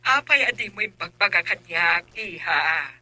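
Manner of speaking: put-on loud, indignant call to the girl; loud scolding. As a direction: scold her loudly, half shouting. Aunt scolds girl about son.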